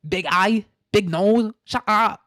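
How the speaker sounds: high, loud, hammed-up Chinese accent; angry mock Chinese accent. Host voices Chinese scolding the Western scholars, angry mock accent. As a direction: yell it in a caricature Chinese accent. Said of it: "what the Chinese were saying".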